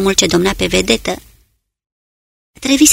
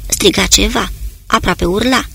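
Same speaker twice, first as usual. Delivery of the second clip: plain reading voice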